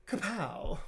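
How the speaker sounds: Kapow thrown up high and punchy. Shown as a demonstration. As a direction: pop the Kapow up like a comic punch. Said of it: "He doesn't say kapow".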